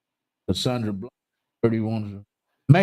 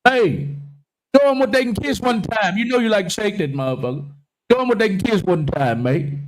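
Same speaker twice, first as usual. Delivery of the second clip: hype call; 7 semitones higher than usual. Real, not acted. To May on camera, hype-man call. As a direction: egg her on like a hype man.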